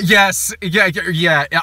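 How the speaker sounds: ordinary delivery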